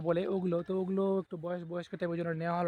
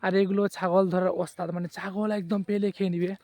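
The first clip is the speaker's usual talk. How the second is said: excited calling out; 8 dB louder than usual. Real, not acted. Calling out while the fox runs.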